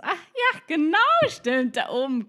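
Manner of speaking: high, loud overdone realisation, settling down; hammed-up sudden remembering; feigned recognition. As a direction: act as if you knew it all along. Pretends she knew it all along.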